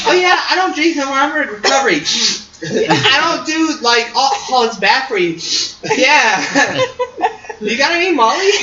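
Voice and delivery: high-pitched, laughing parody voice; mock recovering stripper. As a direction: parody a pious party girl through laughter. Mocking recovering strippers' hypocrisy.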